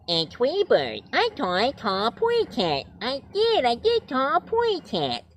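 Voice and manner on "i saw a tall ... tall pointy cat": put-on much higher, baby-talk bird voice; tiny cartoon canary; Tweety Bird voice. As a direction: lisp it like Tweety. Tweety's line.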